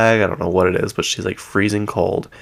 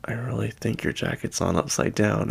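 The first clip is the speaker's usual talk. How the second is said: leaning in, quieter; 8 dB quieter than usual. Retold or imitated. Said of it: "she leans in closer".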